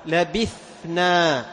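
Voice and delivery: very slow لبثنا, ث breathy before ن; modelling لبثنا; slow clear tha. Shown as a demonstration. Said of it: "توضحها عند النون".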